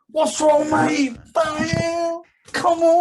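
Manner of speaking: British pub-brawler, spoiling for a fight; British bloke. Retold or imitated. Missing British aggression, mock British lout goading. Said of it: "you miss the old, uh, the British".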